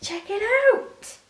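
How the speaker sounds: very high, loud, delighted call; excited showing off. Real, not acted. Vlogger holding up fabric for viewers, high and excited. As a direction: show it off proudly in a high pitch.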